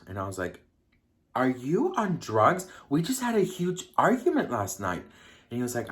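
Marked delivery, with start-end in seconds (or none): 1.3-5.0 incredulous. Retold or imitated. Incredulous reply to boyfriend's invitation.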